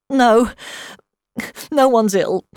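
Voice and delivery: tearful, holding back a sob; stifled sobbing. A narrator's reading. Upset friend on the phone reassuring the caller, stifling sobs. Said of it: "She stifled another sob".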